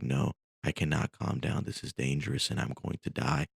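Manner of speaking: plain reading voice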